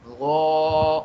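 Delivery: गौ said loud and full, with lips rounded; a thick, rounded Arabic गौ; heavy rounded gau. Shown as a demonstration. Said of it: "खुद ब खुद आपके होंठ गोल होने चाहिए".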